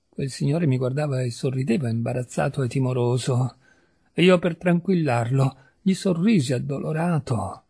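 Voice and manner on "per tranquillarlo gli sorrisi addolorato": ordinary delivery, nothing marked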